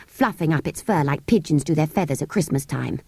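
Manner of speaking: ordinary delivery, nothing marked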